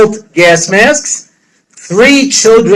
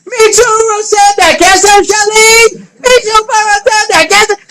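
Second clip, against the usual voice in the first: high shouted Hebrew plea, voice cracking; loud pleading cry; 14 semitones higher than usual. Retold or imitated. Stranded at the bank, shouting high to the clerks. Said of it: "I stood in the middle of the bank with my check saying".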